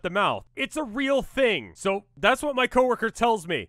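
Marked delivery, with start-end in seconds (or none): none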